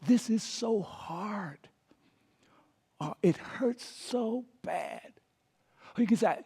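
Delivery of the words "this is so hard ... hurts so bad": slow, soft, hushed comfort; gentle sympathy. Shown as a demonstration. Pastor showing mourners' friends, slow soft sympathy. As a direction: say it gently and slowly to the grieving. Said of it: "here's some things that you can say".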